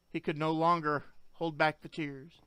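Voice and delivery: usual speaking voice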